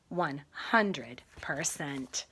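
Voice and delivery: soft hushed whisper; hushed storybook ending. Acted. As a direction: breathe the last words.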